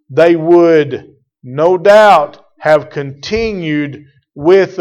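loud, driving pulpit preaching; full-force preaching. Acted. Preacher pressing the point on his congregation, loud and driving.

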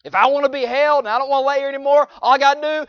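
loud high preacher delivery; preaching at full force. Real, not acted.

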